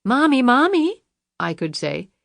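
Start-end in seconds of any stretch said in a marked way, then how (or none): 0.1-1.0 put-on high, pleading little girl voice; mimicking a child. Jackson mocks Luann by imitating her daughter, high and childlike. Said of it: "me mimicking her voice".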